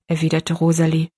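plain reading voice

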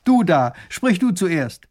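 loud, high command from above; ringing summons; calling out. A narrator's reading. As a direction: pick someone out of a crowd, slow and loud. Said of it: "Der ruft hinauf".

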